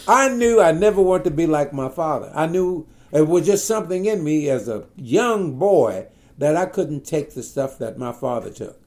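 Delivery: ordinary talk, nothing marked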